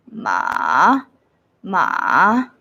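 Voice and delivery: "ma" dipped low, then bouncing up; third tone, bouncing. Shown as a demonstration. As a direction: sink deep into "ma" and let it rise. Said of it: "third tone is the bouncing tone".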